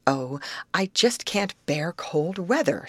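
ordinary delivery, nothing marked

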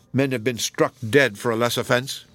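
ordinary delivery

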